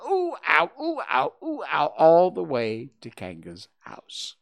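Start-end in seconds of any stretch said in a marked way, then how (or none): 0.1-1.9 alternating high yelps; jolted up and down in a singsong of ooh and ow; bouncing "ooh, ow". A narrator's reading. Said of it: "And he was saying".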